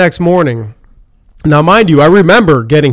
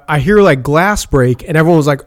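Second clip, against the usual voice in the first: ordinary delivery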